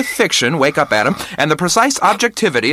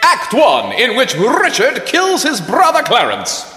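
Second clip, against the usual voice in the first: slow, grand, higher narration; dramatic announcer; 7 semitones higher, slower than usual. Acted. Act intro announcement.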